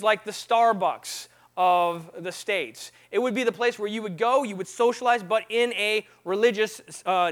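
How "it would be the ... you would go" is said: ordinary talk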